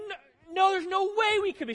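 husband's mock high-pitched disbelief; incredulous denial. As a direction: squeal of denial, refusing to believe it. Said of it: "It's like".

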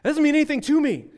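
put-on high, loud kid's protest; ten-year-old son. Ten-year-old son answering dad's life lesson, imitated loud protest. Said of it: "He's like".

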